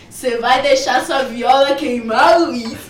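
can barely get the quote out for laughing. Retold or imitated. Retelling Stênio's joke to the show audience, laughing. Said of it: "Stênio apareceu e falou".

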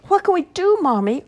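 high, loud panicked cry; boy crying out to mommy as magpie steals bag, high and loud. Acted. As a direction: call out to mommy in alarm. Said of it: "Archie cried out".

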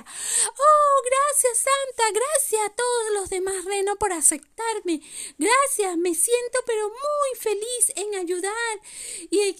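vocecita aguda, llena de gratitud; reno muy feliz. Acted. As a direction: agradece como un renito emocionado. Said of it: "se puso muy feliz y le decía". (pequeño reno feliz y agradecido)

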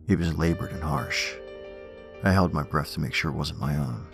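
usual speaking voice

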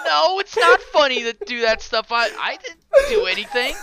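high, loud tearful whine, played for laughs; mock crying. Student denies bullying to the dean, mock crying. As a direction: protest in an exaggerated squealing sob. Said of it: "I'm starting to cry".

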